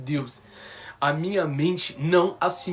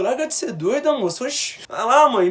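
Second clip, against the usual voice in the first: higher, scoffing brush-off; dismissive disbelief; 8 semitones higher, 5 dB louder than usual. Retold or imitated. Son brushing off the nurse's news, high scoffing. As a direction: wave off the nurse as if she's joking.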